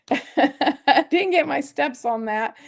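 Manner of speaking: slow, laughing through the words. Real, not acted. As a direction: laugh while saying it slowly. Repeats line laughing.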